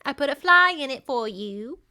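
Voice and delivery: put-on high-pitched little girl voice; sweet tea-party hostess, voiced high; little Elsie. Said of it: "this little Elsie is just like".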